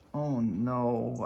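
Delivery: low moan. A narrator's reading. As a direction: groan it out like a sick old lady. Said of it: "I moaned".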